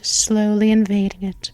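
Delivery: usual speaking voice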